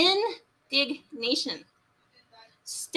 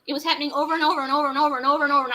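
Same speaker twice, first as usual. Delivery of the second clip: rapid-fire repetition; 2 semitones higher, 3 dB louder, much faster than usual. Real, not acted. To hosts, outraged at repeated harm: rapid-fire. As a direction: rattle off the overs breathlessly. Rant about repeated abuse.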